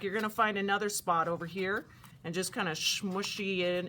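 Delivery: ordinary talk, nothing marked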